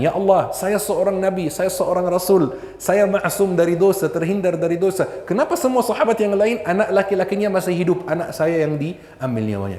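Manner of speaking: lightly voiced anguished plea, pitched high; a hint of a wailing why-me; imitated complaint. Hypothetical complaint of the Prophet. (sedikit meratap, nada tinggi)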